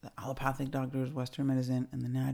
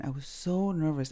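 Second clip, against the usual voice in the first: ordinary talk, nothing marked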